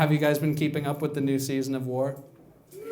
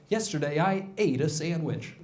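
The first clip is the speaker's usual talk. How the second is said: news anchor voice. Retold or imitated. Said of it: "nobody speaks like this".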